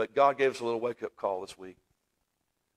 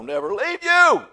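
high, loud, slow cry of the promise; soaring climax; 14 semitones higher, 9 dB louder, slower than usual. Acted. Preacher voicing God's promise, loud soaring cry. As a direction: ring it out to the rafters.

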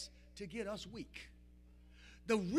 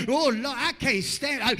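exasperated parent; 11 dB louder than usual. Retold or imitated. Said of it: "Don't that whining just get on your nerves?".

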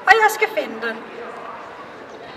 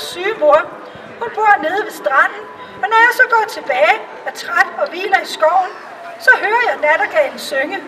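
put-on little kitchen girl; 2 semitones higher than usual. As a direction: speak as a poor little girl, heartfelt. Kitchen girl tells of nightingale.

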